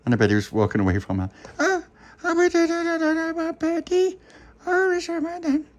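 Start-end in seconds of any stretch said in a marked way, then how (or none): 1.6-5.7 very high, slow babbling; squeaky toddler calling out; baby voice. Acted. Baby Lucy calls after birdie.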